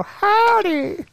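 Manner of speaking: high, hammed-up cowboy howdy; showing the howdy he gave at the restaurant; mock cowboy howdy. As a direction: sing out a goofy howdy. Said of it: "I'll just go".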